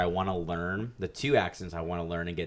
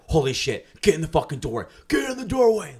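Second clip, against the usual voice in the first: loud, higher mock yell of alarm; imagined yell; 8 semitones higher, 8 dB louder than usual. Yelling at girlfriend to get in the doorway, loud and high. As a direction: bark the order like the quake is on.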